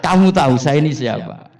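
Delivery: loud, proud challenge; defiant shout. Acted. Umar boasting to Munkar Nakir, loud proud shout. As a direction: throw the question at them like a threat.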